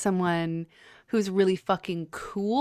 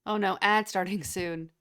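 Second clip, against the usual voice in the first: ordinary talk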